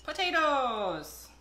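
drawn-out, loud, high showman reveal; game-show host unveiling the prize; grand announcement. Acted. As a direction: announce it like the main act. Big reveal of the potatoes.

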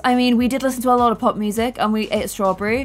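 ordinary talk

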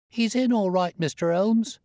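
put-on high, eager boy's cry; young lad shouting news up the street; street boy calling out. A narrator's reading. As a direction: call up to the gentlemen like a street urchin.

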